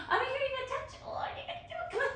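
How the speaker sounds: very high coaxing voice; coaxing a dog. Real, not acted.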